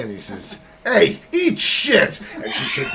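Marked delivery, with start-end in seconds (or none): 0.8-2.2 parrot snapping. Acted. Parrot cursing in joke.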